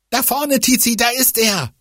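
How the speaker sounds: sehr hoch, atemlos aufgeregt; aufgeregter Ruf. Acted. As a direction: dem Freund etwas zurufen. Verfolgungsjagd, Rufen. (aufgeregter Ruf)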